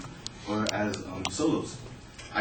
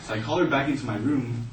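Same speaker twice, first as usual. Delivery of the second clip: ordinary delivery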